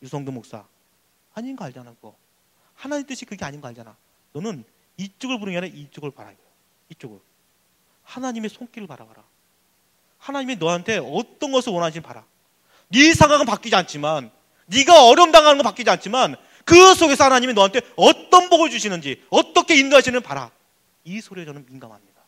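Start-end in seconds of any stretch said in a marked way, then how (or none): 0.0-12.2 soft, restrained divine admonition; still small voice. Acted. Holy Spirit speaking inside the pastor's heart, hushed admonition. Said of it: "이 소리는 세미한 음성이에요". 12.9-20.5 building, loud divine charge; forceful exhortation. Acted. Holy Spirit urging the pastor to look, forceful.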